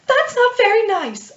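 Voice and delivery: high, loud, drawn-out outburst; shrieky cross outburst from a meek character; angry timid healer. Acted. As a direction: scold slowly at the top of a small voice. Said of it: "she gets really angry".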